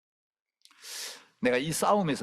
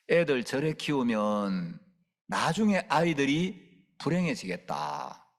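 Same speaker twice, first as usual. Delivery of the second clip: ordinary talk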